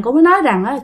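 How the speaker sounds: plain reading voice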